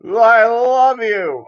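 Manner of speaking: high, slow mock holler of a drunk buddy; imitated drunken yell. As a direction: wail it out slowly, high-pitched, between retches. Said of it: "I hear my buddy yell out".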